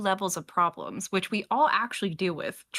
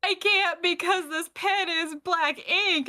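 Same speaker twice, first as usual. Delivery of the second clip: mock solemn reason; 10 semitones higher, slower than usual. Imitating person's odd reason.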